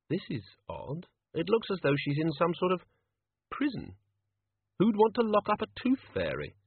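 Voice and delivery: wondering, light-voiced character; puzzled Susan. A narrator's reading. Narrator voices Susan puzzling aloud.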